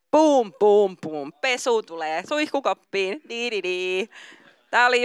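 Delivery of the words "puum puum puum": playful thumping noises, first loud then fading; mock thud sounds. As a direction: boom it out like something dropping. Imitating a thudding sound.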